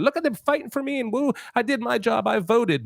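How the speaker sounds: high, fast, self-satisfied voice; mock a voter patting himself on the back; high-pitched parody of a smug voter. Mocking a complacent voter.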